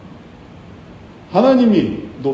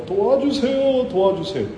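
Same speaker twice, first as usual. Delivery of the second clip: crying out; 7 semitones higher than usual. Acted. Beggar cries to Jesus over the crowd, crying out. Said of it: "그는 더 크게 소리 지르면서".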